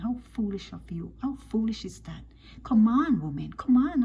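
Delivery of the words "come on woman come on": loud, exasperated scolding. Real, not acted. Commentator scolds women for their foolishness, loudly exasperated. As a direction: burst out on each "come on".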